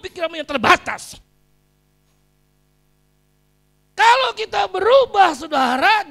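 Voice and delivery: loud, high-pitched preaching shout; khotbah berapi-api. Real, not acted. Preaches at full force. (berkhotbah lantang dengan nada tinggi)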